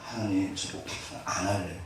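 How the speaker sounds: muffled despairing prayer to God; quiet giving-up prayer. Retold or imitated. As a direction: confess you are done, barely audible. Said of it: "저한테 장난 아닙니다".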